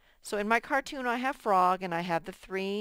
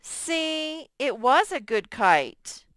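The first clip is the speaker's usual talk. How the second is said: Frog's voice, raised; 7 semitones higher, 5 dB louder, slower than usual. Acted. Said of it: "he could say something like".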